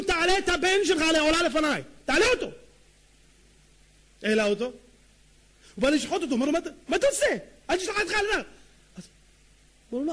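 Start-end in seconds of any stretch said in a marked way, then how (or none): 0.0-2.5 high, loud, forceful order; raised preacher's voice quoting God; booming divine command. Acted. God's command to Abraham, acted. 6.5-8.5 high, loud cry of protest; urgent high exclamation; alarmed stop command. Acted. Acted shout stopping Abraham.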